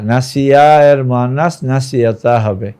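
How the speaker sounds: usual speaking voice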